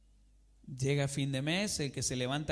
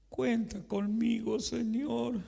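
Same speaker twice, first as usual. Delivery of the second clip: desperate; 6 semitones higher, slower than usual. Retold or imitated. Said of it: "sino de desesperación".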